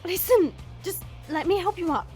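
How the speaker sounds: rising, upset and flustered; high, broken-off panicky excuses; distressed apology. Acted. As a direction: stumble through a regret, near tears at the end. Flustered apology after injuring him.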